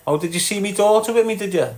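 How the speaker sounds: fast, amused did-you-now; mock surprise. Real, not acted. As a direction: pounce on it like you've been caught out. Reacts to comment about daughter.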